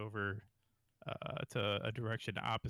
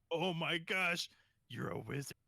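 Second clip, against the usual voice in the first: awestruck; 8 semitones higher, 4 dB louder than usual. Acted. Geth awestruck.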